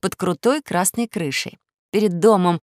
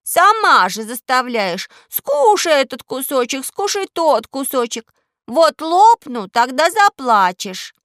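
put-on high, loud, slow whining girl; capricious little princess; 7 semitones higher, 6 dB louder, slower than usual. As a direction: whine like a spoiled child. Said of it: "капризно сказала".

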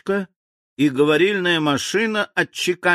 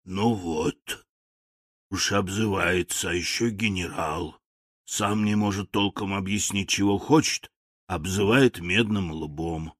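low, soft, grumbling machine; hoarse machine voice; 4 semitones lower, 5 dB quieter than usual. A narrator's reading. Machine complains about the insulting general, hoarse and low. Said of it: "донёсся её собственный хриплый голос".

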